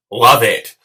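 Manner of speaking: love it, belted out loud; loud exclamation. Real, not acted. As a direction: punch it out loud.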